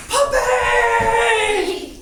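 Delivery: shrieking high and loud at a puppy; overexcited shout. Shown as a demonstration. Kid rushing at a strange dog, wildly excited shout. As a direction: burst out with it, way too eager. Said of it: "do you run up to that dog".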